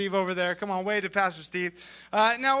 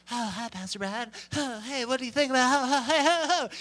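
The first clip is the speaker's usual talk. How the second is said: lightly put-on gushing greeting; mock over-nice; 3 semitones higher than usual. Imitating people who want something from him, gushing niceness. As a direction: fawn over the pastor, rising in pitch. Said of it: "they're being really nice".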